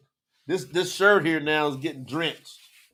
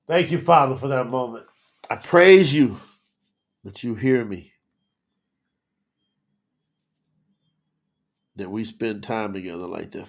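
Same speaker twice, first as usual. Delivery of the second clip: heartfelt prayer; 4 semitones lower, 5 dB louder than usual. Real, not acted. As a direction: start strong, then drop into an intimate prayer. Prays aloud to the Father.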